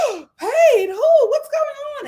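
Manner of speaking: high, loud disbelieving questions; shocked high squeal. Retold or imitated. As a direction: shriek it like scandalized ladies. Ladies shocked at the reveal.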